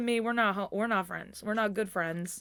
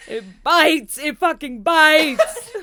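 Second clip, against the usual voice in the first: loud, slow, laughing complaint; 6 semitones higher, 11 dB louder, much slower than usual. Real, not acted. Laughing complaint about cold.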